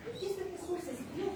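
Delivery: imitated challenge, pitched high; retold indignant challenge. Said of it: "se me alzó". (imitando una pregunta indignada, en tono alto)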